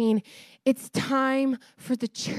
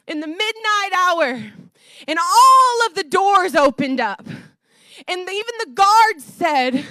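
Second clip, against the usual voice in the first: raised, higher preaching voice; fervent preaching; 6 semitones higher, 5 dB louder than usual. Real, not acted. Preacher telling the church of midnight worship in prison, fervent. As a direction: build the story with rising fervor, punching key words.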